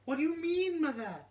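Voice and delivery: put-on very high, loud little child voice; shrill fairy child insisting; changeling child. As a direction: squeak it out like a tiny insistent child.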